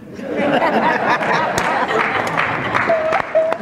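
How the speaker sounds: a high-pitched real laugh bursting out. Real, not acted. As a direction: crack up after the punchline. Laughs after cash punchline.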